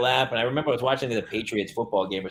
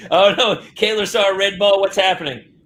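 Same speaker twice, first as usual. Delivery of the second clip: mock panic; 7 semitones higher, 6 dB louder than usual. Precog panicking about the vision.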